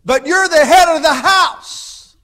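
high, loud, slow divine shout with a laugh; booming rebuke. Retold or imitated. Preacher voicing God scolding Norval, loud and slow. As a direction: thunder it out as God scolding the man.

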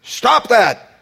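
loud, high preacher's command; forceful preached rebuke. Acted. Preacher voicing Jesus to frightened disciples, loud high rebuke. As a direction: thunder it out as a firm order.

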